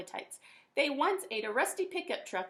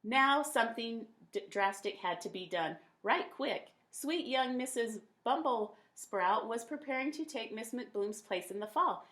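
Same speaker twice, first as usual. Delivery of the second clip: usual speaking voice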